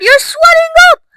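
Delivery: very high, loud cry; horrified scream. A narrator's reading. Mother watching Violet swell, screaming. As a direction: shriek it in panic. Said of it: "screamed Missus Beauregard".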